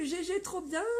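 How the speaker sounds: ordinary talk, nothing marked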